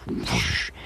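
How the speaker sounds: breathy whoosh of rushing energy; whispered whoosh. Retold or imitated. Telling viewers how the attack passed, whispered whoosh. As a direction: push it out on air like a gust. Energy blast hitting his shield.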